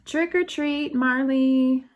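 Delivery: put-on high, loud kids' chorus; excited trick-or-treat call. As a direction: call it happily at the door. Children at the door.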